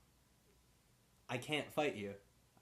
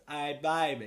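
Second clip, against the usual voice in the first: mock Forrest Gump accent; 2 semitones higher, 8 dB louder than usual. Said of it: "it's like Forrest Gump, kinda".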